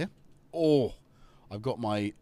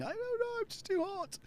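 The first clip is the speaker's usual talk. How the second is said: much higher squeaky cat voice; voicing the cat; 6 dB quieter than usual. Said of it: "He was like".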